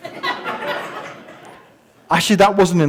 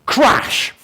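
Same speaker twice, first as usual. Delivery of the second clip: mock crash sound; 7 semitones higher than usual. Mimics man falling off ladder.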